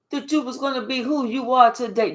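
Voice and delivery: ordinary talk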